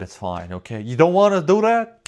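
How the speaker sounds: normal talking voice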